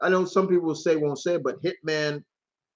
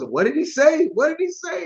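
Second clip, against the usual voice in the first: high-pitched baffled question; mock bafflement; 13 semitones higher, 3 dB louder than usual. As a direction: squeak it, totally lost. Acting baffled cartoon listeners.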